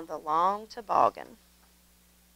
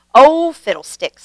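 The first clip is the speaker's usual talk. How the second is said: sniffy; 4 semitones higher, 6 dB louder than usual. A narrator's reading. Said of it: "sniffed Grandpa Dan".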